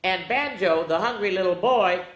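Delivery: usual speaking voice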